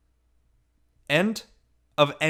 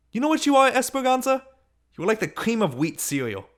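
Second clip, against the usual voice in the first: taunting shout; 9 semitones higher than usual. Acted. Girl hurls an insult at Esperanza, taunting shout. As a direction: sneer it at her across the yard. Girls trading insults in yard.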